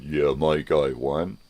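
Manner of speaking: slow, smug put-on voice; mock gloating partisan. Mocking a partisan voter's gloating, in a slow, smug put-on voice. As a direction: gloat slowly like a winner. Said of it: "If you're saying".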